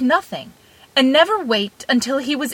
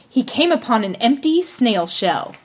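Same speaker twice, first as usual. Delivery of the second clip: ordinary delivery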